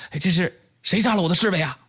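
high, quick startled question; astonished question. Acted. Waking man asks who killed his guard, astonished. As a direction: blurt it out high and fast, acting astonished. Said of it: "惊讶地问道".

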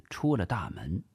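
ordinary delivery, nothing marked

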